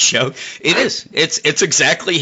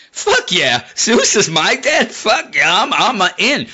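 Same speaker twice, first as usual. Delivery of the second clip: higher, gloating fake cheer; mock boastful brag; 8 semitones higher than usual. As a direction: ham up a smug demigod bragging. Mocking a boastful Diana.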